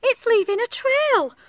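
put-on high, loud childlike exclamation; excited children. A narrator's reading. Children notice the silvery trail, high excited voice. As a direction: announce it high and loud like a pupil. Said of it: "say the children".

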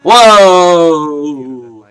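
loud, high whoa, long and falling; startled whoa. Real, not acted. Gamer seeing some guy climb that thing, amazed cry. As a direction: cry out in amazement, stretching the word.

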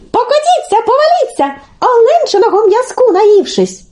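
very high taunting little voice; calling out. A narrator's reading. Telesyk calls from the maple to the snakes, high and taunting. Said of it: "телесик знову вигукує".

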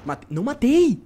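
shocked outburst. Real, not acted. Streamer crying out when his kill fails. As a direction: cry out that the shot missed.

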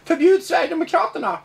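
high-pitched protest cry; mock shouting. Mimicking the left demanding a party ban, mock yell. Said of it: "för de skriker ju".

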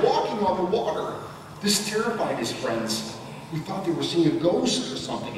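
ordinary delivery

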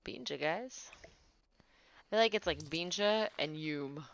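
ordinary talk

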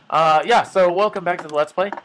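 normal talking voice

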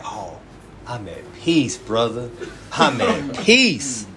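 drawn-out, hushed mock calm; mock serene brother. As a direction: imitate someone blissfully at peace. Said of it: "this is how they speak".

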